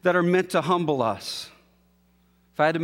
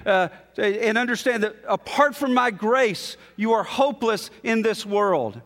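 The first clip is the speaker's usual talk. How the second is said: emphatic preaching; 6 semitones higher than usual. Acted. Pastor preaching God's reply to his listeners, emphatic and rising. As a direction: press God's words home to the congregation.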